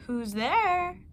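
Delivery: a frail grandmother calling out from bed; quavering high "who's there"; high old granny voice. Acted. Grandmother in bed asks who is knocking, high and frail.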